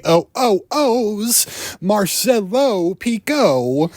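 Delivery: high, slow, drawn-out radio jingle voice; smarmy DJ crooning his own name; stretched DJ flourish. Acted. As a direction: stretch the name out like a station ident. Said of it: "that whole radio D J, you know, bit".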